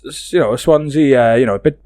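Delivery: ordinary delivery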